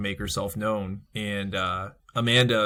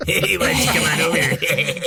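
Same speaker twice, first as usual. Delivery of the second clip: high, loud sleazy lure; creepy alleyway dude; 6 semitones higher, 5 dB louder than usual. Retold or imitated. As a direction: coax them like a creep in an alley. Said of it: "some creepy dude trying to talk someone into an alleyway".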